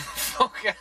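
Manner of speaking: ordinary talk, nothing marked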